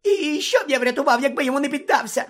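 very high, squeaky whine; stammer, then rush the excuse in a squeal; cowardly high-pitched pleading; panicked Pettigrew. Acted.

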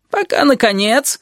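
high-pitched exasperated lead-in; getting worked up. A narrator's reading. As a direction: wind up for the next grievance. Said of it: "распаляясь всё сильнее". (распаляясь, с нажимом)

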